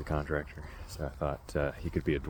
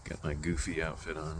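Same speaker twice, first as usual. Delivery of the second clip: ordinary talk, nothing marked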